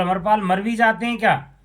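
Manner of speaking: quick, high, disbelieving question; can Amarpals even die, asked in astonishment; incredulous. Retold or imitated. Bewildered traveller questioning the mourners, fast and incredulous.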